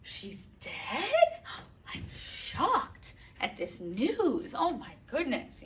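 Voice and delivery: hammed-up fake gasp of shock, slow. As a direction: overact surprise like a bad liar. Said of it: "told the news and be like".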